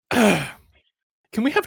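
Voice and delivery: high-pitched restless babble; antsy, high and needy; jittery unease. Acted. Acting jittery withdrawal from the phone.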